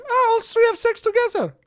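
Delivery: put-on high, loud shout in a Russian accent; a high mock Russian shout, shocked. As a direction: shout it at them in disbelief. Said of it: "Like, shouting at them".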